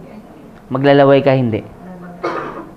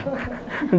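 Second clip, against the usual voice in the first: quick airy laugh after the punchline; soft breathy chuckle; 9 semitones higher, 12 dB quieter than usual. Real, not acted.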